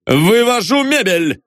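high, drawn-out official proclamation; resolute, ringing declaration; decisive announcement. A narrator's reading. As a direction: declare it with finality, slowly. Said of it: "решительно заявил Бендер".

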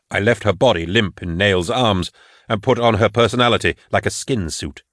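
plain reading voice

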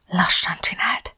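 slow, breath-only whisper; trembling whisper. A narrator's reading. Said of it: "súgta reszketve". (lassú, reszkető suttogás)

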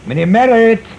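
loud, high, slow sugary call; calling upstairs. Acted. Husband calls up the stairs to the lodger, falsely sweet.

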